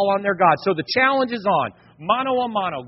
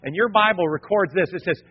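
ordinary talk, nothing marked

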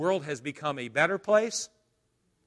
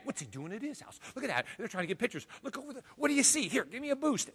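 much softer, higher gossiping murmur; crowd muttering; 8 semitones higher, 7 dB quieter, faster than usual. Acted. Crowd in the courtyard whispering and gossiping, softly. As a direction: mutter it like nosy onlookers.

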